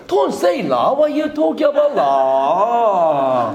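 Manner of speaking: slow mock Hong Kong accent with la endings; Hong Kong English imitation. Lecturer imitates Hong Kong people, slow mock Hong Kong English. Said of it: "홍콩 사람 만나면 이렇게 합니다".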